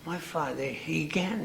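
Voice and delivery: ordinary talk, nothing marked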